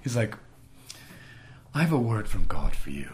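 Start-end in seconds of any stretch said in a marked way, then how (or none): 1.7-3.1 imitated British accent; solemn message in a mock British voice; quirky British man. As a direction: play the quirky Brit. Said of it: "this quirky British dude".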